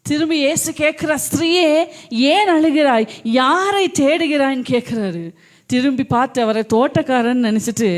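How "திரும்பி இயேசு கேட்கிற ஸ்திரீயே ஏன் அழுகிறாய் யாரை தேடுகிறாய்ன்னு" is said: fervent verse reading. Acted. Preacher reads verse fervently.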